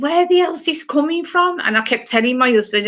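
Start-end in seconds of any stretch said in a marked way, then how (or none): 0.0-1.6 high, puzzled disbelief; baffled inner thought. Retold or imitated. Recalling the voice nagging her, asks herself in high bewilderment. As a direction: ask yourself, bewildered. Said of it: "And I kept thinking".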